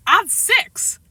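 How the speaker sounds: put-on high small-kid voice; little boy. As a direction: announce it as a six-year-old. Small boy Daniel voiced in story.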